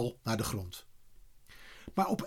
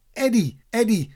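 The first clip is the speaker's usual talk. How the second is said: higher, louder two-fold call; 8 semitones higher, 5 dB louder than usual. A narrator's reading. Kees calls his friend back, calling out. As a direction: call his name across to him. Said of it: "hoorde hij opeens Kees roepen".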